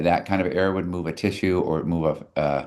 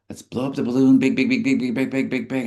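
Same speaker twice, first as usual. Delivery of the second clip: excited blowing up; 6 semitones higher, faster than usual. Pretend.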